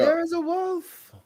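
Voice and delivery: high, slow mock-amazed announcement; mock awe. Watching the dog yawn, slow high mock wonder. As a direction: marvel at the dog like a nature host.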